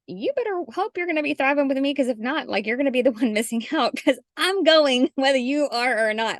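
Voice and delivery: mock-bold laughing brag; cocky confidence. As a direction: playfully threaten them with your confidence. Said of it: "I come across with the energy of".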